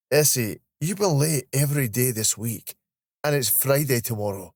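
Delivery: friendly Scottish reproach; chiding Scottish colleague. Acted. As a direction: mildly tell off a friend in Scots. Voices Steve, Scottish colleague.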